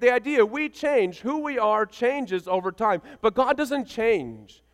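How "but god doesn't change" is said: ordinary delivery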